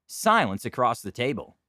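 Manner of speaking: ordinary talk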